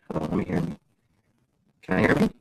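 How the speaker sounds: normal talking voice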